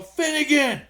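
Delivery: high call back across the crowd. Retold or imitated. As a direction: shout the name back, delighted. Friend calls back his name.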